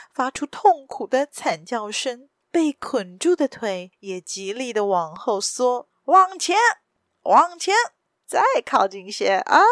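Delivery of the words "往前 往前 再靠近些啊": loud villain's shout, urging them on; sneering roar. A narrator's reading. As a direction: roar orders at your men with a cruel sneer. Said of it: "那领头的人冷笑地吼着". (冷笑着大声吼叫)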